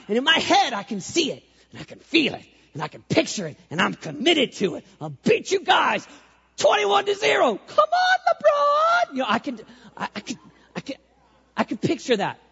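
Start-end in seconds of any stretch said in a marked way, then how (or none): 5.0-9.1 high, loud squeaky heckle; squeaky shouted trash talk; heckling shout. Retold or imitated. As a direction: yell at LeBron like a cocky heckler, climbing up. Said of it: "heckling LeBron".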